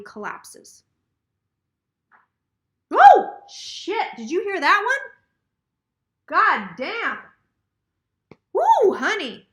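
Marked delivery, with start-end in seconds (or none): 2.9-9.5 sudden loud, high "oh shit" at a noise; real shock at a bang in the room; startled outburst. Real, not acted. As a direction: jump at the noise and blurt it out.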